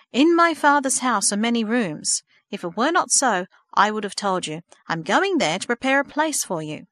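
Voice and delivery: measured, emphatic recital of scripture; scripture reading. A narrator's reading. Minister reading a verse to a girl, solemnly emphatic. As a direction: proclaim the verse, stressing each phrase.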